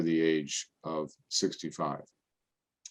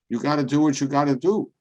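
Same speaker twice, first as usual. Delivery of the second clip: friend's advice; 7 semitones higher, 7 dB louder than usual. Retold or imitated. Friend Joey quoted.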